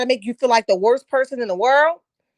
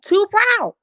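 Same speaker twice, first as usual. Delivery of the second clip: too proud again; 10 semitones higher, 4 dB louder than usual. Shown as a demonstration. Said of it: "Say it again".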